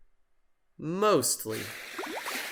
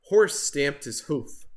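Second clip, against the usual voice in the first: plain reading voice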